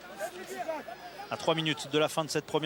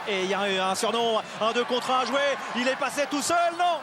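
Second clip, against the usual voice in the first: esports caster; 7 semitones higher than usual. Real, not acted. Live rugby break, commentator erupts.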